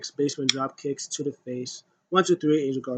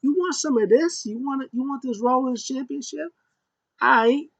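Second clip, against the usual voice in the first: high, taunting mock voice; imitating Sasha; 8 semitones higher than usual. Vlogger imitating Sasha taunting Shayna, high and cocky. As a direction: taunt like a cocky champion, pitched up. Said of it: "She was like".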